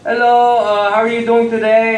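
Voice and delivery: put-on Indian shopkeeper accent. Store owner greeting a customer, in an Indian accent. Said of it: "I try to use my accent".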